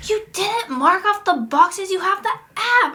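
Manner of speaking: high, slow, exasperated scolding; upset scolding. Retold or imitated. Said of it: "She was mad".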